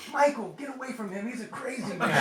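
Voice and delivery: high, loud alarmed yell breaking into a laugh; frantic warning. Retold or imitated. Grandma yelling at the grandson to get away, alarmed. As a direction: shout the kid away from danger.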